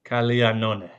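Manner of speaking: "Caglianone" copied carefully, gli as ly; careful repeated pronunciation. Shown as a demonstration. Host who can't say it trying again, careful Italian pronunciation. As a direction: repeat "Caglianone" after the teacher, Italian style. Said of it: "Say it, Caglianone".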